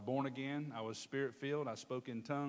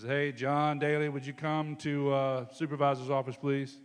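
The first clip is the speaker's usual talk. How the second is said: paging over the microphone; 9 dB louder than usual. Retold or imitated. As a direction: call him in over the loudspeaker. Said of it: "He gets on the microphone".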